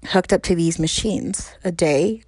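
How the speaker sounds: usual speaking voice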